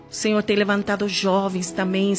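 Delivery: ordinary delivery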